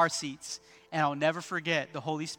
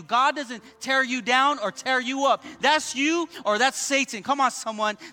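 raised, punchy sermon delivery; higher-pitched, emphatic preacher cadence; preaching; 6 semitones higher, 5 dB louder than usual. Real, not acted. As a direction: drive each point home to the congregation. Preaches to congregation.

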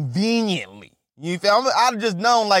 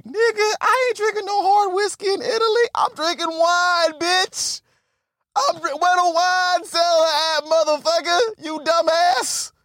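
indignant rant; 9 semitones higher than usual. Real, not acted. Indignant rant about whiskey in Italy.

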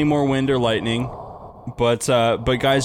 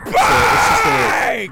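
laughing; 7 semitones higher, 6 dB louder, much slower than usual. Real, not acted. Wind effect laughing breakdown.

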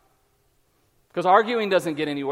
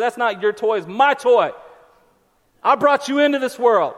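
mock-stern dad, building louder; old-school parent; 3 semitones higher, 4 dB louder than usual. Dad telling kids whose toy it is, mock stern. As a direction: lay down the law like a parent. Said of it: "I get old school on 'em".

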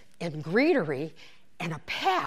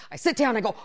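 ordinary talk, nothing marked

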